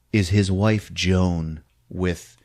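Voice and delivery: usual speaking voice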